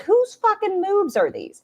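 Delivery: high, drawn-out incredulous question; retold scandalized reaction, stretched out high; mock outrage. Retold startled reaction to screenshot.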